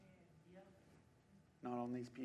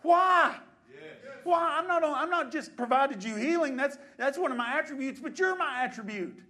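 loud, raised-pitch urgent remonstrance; full-force preaching as God; 8 semitones higher, 10 dB louder than usual. Acted. God to believers burdened by the world's pressure, loud impassioned reproach. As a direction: preach it hard, as God questioning his own.

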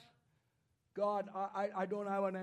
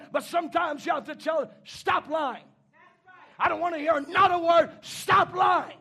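raised, hard-hitting preacher's voice; forceful preaching; 7 semitones higher, 10 dB louder than usual. Acted. Preacher telling parents to confront lying children, forceful preaching. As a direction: build to a shouted rebuke.

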